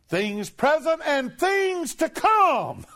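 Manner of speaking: high, slow, loud cry; preaching shout. Real, not acted. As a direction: stretch each word out in triumph. Preacher proclaims climax.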